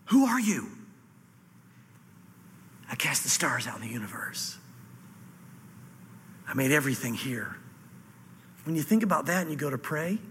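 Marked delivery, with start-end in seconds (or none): 0.1-0.7 stunned, awed question; astonished. Acted. Disciples to Jesus after the calmed storm, astonished. 2.9-7.6 low, near-whispered revelation; hushed reply. Acted. Jesus answering the stunned disciples, hushed and low.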